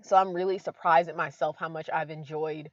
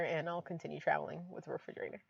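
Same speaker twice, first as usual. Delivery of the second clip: usual speaking voice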